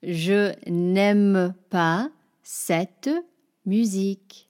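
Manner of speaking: very slow, careful French sentence; French model. Shown as a demonstration. Teacher models example sentences for learners, very slow. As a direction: draw each French word out slowly. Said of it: "give you some other examples".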